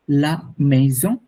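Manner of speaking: লা মেইজন said slowly, each syllable clear; careful slow French "la maison"; slow French demo. Shown as a demonstration. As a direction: spell out লা মেইজন slowly for the class. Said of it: "pronunciation কিন্তু literally same".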